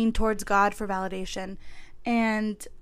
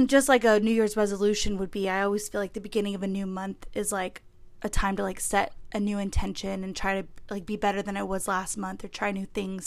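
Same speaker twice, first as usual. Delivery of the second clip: ordinary talk, nothing marked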